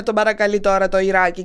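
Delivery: plain reading voice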